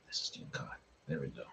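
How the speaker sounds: whispered aside. Real, not acted. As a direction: whisper it under breath.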